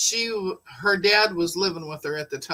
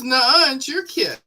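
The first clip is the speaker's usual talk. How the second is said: mock childish denial; 7 semitones higher, 4 dB louder than usual. Retold bedtime argument with husband.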